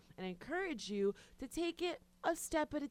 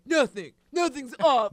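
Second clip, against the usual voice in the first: mock grumpy denial; 3 semitones higher, 11 dB louder than usual. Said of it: "You're like".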